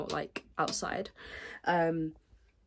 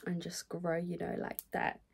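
whisper; 10 dB quieter than usual. Real, not acted. Whispered aside.

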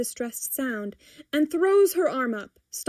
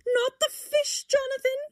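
sudden cry. A narrator's reading. Timothy trying to stop him feeding fish, crying out. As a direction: cry out in a much higher voice. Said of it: "Timothy cries suddenly".

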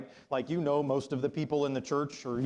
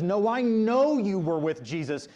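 loud, high, slow accusation; insistent accusation; 8 semitones higher, 11 dB louder, slower than usual. Retold or imitated. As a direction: accuse him forcefully, stretching the words. Acting an accuser confronting Peter.

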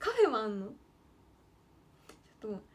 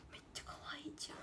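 fast breathless whisper of delight; a secret, whispered gush over a cute otter; 2 semitones higher, 18 dB quieter, much faster than usual. Real, not acted. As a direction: whisper めっちゃかわいいじゃん to yourself, rushed and voiceless. Gushing over otter picture.

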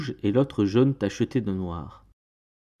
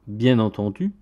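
plain reading voice

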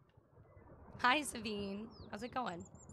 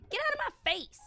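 high, louder mock-angry snap at a stranger; play-yell at a rude stranger; indignant yell; 13 semitones higher, 5 dB louder, faster than usual. Yelling back at game character.

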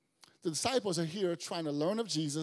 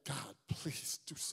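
quiet plea; 3 semitones lower, 8 dB quieter, slower than usual. Retold or imitated. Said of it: "you're like".

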